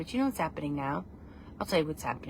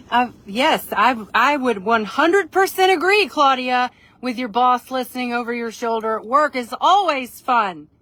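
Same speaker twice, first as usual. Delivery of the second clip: loud, higher, overdone endorsement; mock-earnest agreement; 6 semitones higher, 9 dB louder than usual. Agreeing with friend for her listening boss, loudly mock-earnest. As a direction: proclaim it for an eavesdropping boss.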